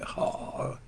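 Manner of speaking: a breathy unvoiced ja of amazement; imitating Brother David Quesada. Preacher imitating a brother's amazed ja. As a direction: whisper a short amazed ja. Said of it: "él siempre a veces dice".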